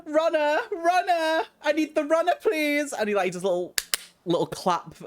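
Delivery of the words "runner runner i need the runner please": high, loud calling out; calling for runner. Acted. Boy leader calls loudly for his runner. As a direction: call for the runner like a child. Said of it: "he kind of starts going".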